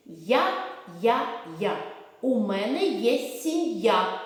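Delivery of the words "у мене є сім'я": slow, deliberate phrase; sing-song teacher model; slow drill. Shown as a demonstration. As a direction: say each word clearly for kids. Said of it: "Повторюйте за мною чистомовки".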